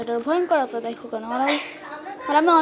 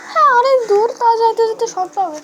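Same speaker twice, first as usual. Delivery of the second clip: put-on much higher, louder girl's voice; high girlish answer; 8 semitones higher, 7 dB louder than usual. Samira tells Raj the house is far, high loud girl voice. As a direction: chirp it back like a little girl.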